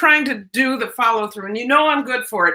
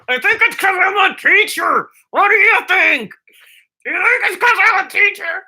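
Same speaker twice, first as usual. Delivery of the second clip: playful put-on voice; 9 semitones higher, 4 dB louder than usual. Playful high voice to viewers.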